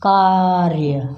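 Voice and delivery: कार्य said very slowly and clearly; a slow teacher's कार्य; slow demo. Shown as a demonstration. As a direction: stretch कार्य out for the class. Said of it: "जल्दी से उच्चारित करना है".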